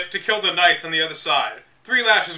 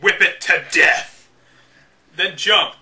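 slow, louder, savoring "Whip it to death"; relishing violent line; 4 dB louder, slower than usual. Shown as a demonstration. Rereading violent guide line, slow and relishing. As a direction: dwell on the brutality with mock menace. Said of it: "Surprisingly violent descriptions".

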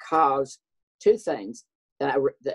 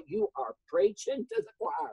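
sassy retort; 7 semitones higher, 6 dB quieter than usual. Retold or imitated. Quoting own sassy reply.

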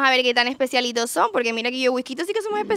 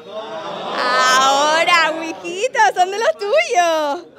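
loud, high, drawn-out exclamation; excited cheer; 3 semitones higher, 5 dB louder, much slower than usual. Real, not acted. Host telling Whiskito they are his kind, excited cheer. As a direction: crow it slowly with rising delight.

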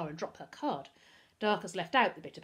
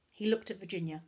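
ordinary delivery, nothing marked

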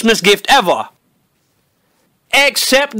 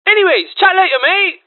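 fake cheerful; 5 semitones higher than usual. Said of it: "doing the following".

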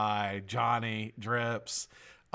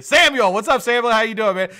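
high, loud shouted greeting; excited call-out; 10 semitones higher, 11 dB louder than usual. Real, not acted. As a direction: hail a friend with big energy. Hailing an arriving viewer.

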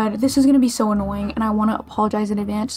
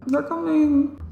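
imitating Paul Revere's cry; 5 semitones higher, slower than usual. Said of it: "He was like".

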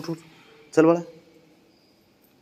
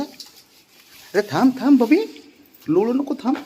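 high pleading; 14 semitones higher, slower than usual. Real, not acted. Pet rolling on the floor.

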